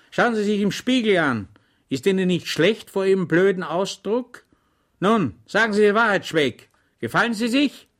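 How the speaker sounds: loud, high mocking rebuke; scornful scolding. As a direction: tear into the dim servant. Officer scolds his foolish batman. (höhnisch scheltender Offizier)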